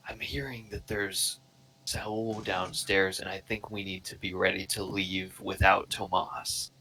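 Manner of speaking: soft, slow, urgent whisper. Acted. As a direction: whisper so nobody downstairs hears. Waking a sleeper in secret.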